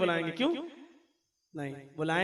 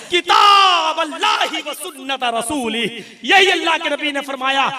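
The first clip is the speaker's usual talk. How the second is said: loud, high preaching call; full-voiced sermon cry; fiery preaching; 5 semitones higher, 5 dB louder than usual. Real, not acted. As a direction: rouse the crowd toward the faith.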